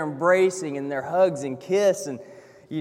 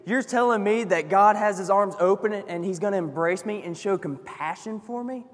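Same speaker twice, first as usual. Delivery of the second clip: ordinary talk, nothing marked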